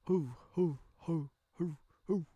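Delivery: high, rhythmic "hoo" calls; imitated dwarf chant. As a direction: hoot like saluting dwarves. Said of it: "and making noises".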